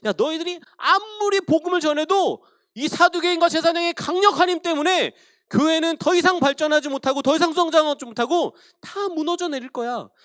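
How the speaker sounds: lightly voiced devil crowing about collapse; devil's gloating. Devil mocking the church's growth, lightly voiced gloating. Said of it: "또".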